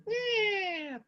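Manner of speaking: high squeaky creak of a door; creaking door sound. Retold or imitated. Preacher mimics the door creaking open, squeaky. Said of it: "Dibuka sama ibu itu".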